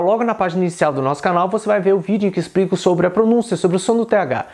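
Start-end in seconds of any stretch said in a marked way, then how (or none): none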